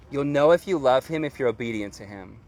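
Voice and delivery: ordinary talk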